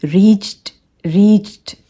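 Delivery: रीच्ड as "reecht"; tiny t closing रीच्ड; one-syllable रीच्ड; soft T ending. Shown as a demonstration. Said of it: "reach में भी हमने जब ED".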